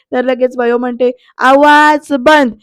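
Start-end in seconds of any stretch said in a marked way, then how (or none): 1.4-2.5 loud, drawn-out order for silence; angry command. Retold or imitated. Bayo silencing Sarika mid-quarrel, slow loud angry command. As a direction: stretch each word into a stern shout.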